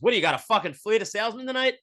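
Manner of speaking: a high, loud mocking jab at the audience guy; mock comedian roast. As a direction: needle him like a comic working the front row.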